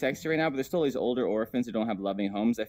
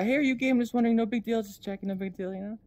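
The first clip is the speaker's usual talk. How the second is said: higher, overly casual mock question; mock curious asker; 10 semitones higher, faster than usual. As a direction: play a fake-casual asker fishing for gossip. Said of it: "but I don't get, uh".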